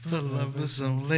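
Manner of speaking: smooth late-night radio host. Shown as a demonstration. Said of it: "give us a little bit of it".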